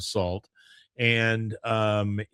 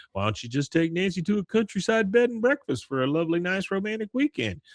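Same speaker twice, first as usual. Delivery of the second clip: clearly higher, pleading Bill voice; Bill voiced; 9 semitones higher than usual. Podcaster doing Bill suggesting a romantic weekend, higher. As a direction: coax it up high like Bill. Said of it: "Bill says".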